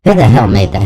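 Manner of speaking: normal talking voice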